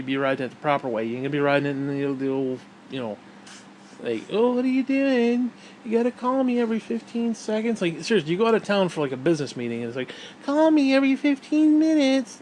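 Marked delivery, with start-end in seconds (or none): 4.3-7.8 mock a needy partner, stretching the words; clingy partner. Mimicking a clingy partner on phone. 10.4-12.4 high, loud mocking whine; clingy partner. Mimicking a clingy partner on phone.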